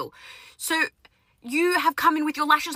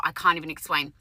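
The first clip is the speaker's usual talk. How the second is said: ordinary talk, nothing marked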